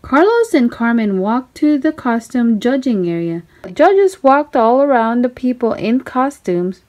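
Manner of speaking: ordinary delivery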